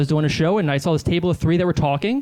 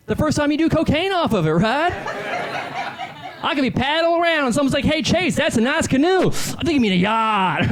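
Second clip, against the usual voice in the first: high-pitched, cocky hack comedian; Chase Freely; 8 semitones higher than usual. Acted. Successful comedian bragging to a club crowd, cocky high-pitched delivery. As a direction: swing the pitch up high on each setup and punchline.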